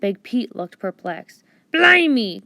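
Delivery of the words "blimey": loud, higher pirate blimey; louder, astonished blimey; pirate exclamation. Acted. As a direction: cry it out like a startled pirate. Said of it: "cried Big Pete".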